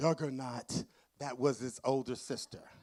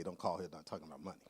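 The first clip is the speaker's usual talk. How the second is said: lower, softer, fast mutter of "Don't call here not talking about money."; quiet rushed version; 9 semitones lower, 6 dB quieter, much faster than usual. Retold or imitated. Author taking back what she told the publisher, muttered low and fast. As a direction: rattle it off under your breath. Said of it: "and I didn't say".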